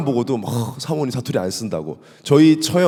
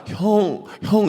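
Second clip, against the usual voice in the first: 어색한 형; 7 semitones higher, much slower than usual. Shown as a demonstration. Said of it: "너무 막 느끼하고".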